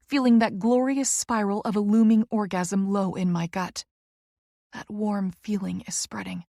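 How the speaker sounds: plain reading voice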